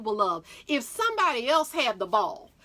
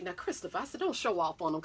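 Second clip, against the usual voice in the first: softer, lower, gently cautioning; motherly warning; 6 semitones lower, 7 dB quieter, faster than usual. Retold or imitated. Mom warning her son not to show off, soft. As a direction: hush him like a mother would.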